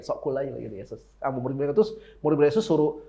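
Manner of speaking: normal talking voice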